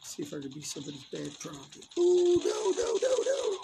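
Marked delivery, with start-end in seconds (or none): 2.0-3.6 high panicked shout of no; shouting orders over battle. Real, not acted. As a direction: yell it out as the fight turns bad. Panicked in a firefight.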